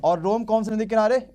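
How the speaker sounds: usual speaking voice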